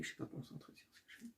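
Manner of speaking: barely audible muttered aside, fast; whispered aside. Real, not acted. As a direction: whisper it quickly to yourself. Muttered self-correction aside.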